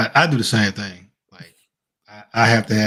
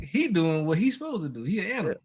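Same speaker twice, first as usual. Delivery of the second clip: put-on higher, scolding the kids at dinner; lecturing parent; 6 semitones higher, faster than usual. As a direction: lay down the table rules. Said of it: "I'm like".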